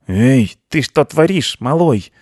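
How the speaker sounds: loud indignant uncle mouse. A narrator's reading. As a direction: call the youngster out sharply. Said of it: "возмутился мыши дядя".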